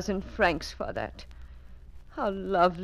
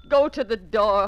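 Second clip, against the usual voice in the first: ordinary delivery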